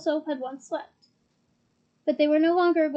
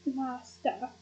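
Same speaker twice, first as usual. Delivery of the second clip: croaking elf; 2 semitones lower, 7 dB quieter than usual. A narrator's reading. House elf croaking to his new master. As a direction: croak it like a bitter old elf. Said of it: "croaked the creature in his full frog's voice".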